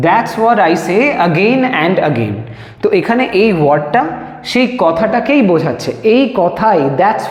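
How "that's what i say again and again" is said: very slow, each word set apart; slow dictation. Shown as a demonstration. Teacher reading the finished English sentence aloud, very slow. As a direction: read the full sentence out slowly for students to copy. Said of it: "okay?".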